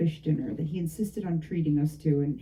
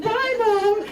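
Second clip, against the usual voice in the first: much higher, sing-song "Bye, Mom."; cheery goodbye; 5 dB louder than usual. Retold or imitated. Daughter answers her mother's goodbye, high sing-song. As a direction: chirp it back brightly.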